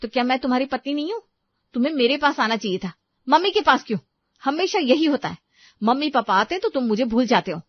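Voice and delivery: high, loud accusations; aggrieved angry wife. Acted. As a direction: reproach the husband for turning to his parents. Wife quarrels with husband. (गुस्से में शिकायत करती पत्नी)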